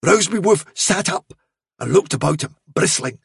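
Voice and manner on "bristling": ordinary delivery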